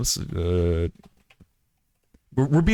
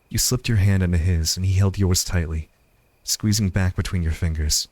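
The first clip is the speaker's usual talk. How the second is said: low, measured storytelling; tender closing narration; 4 semitones lower than usual. A narrator's reading. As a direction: close the love story gently, in a lower register.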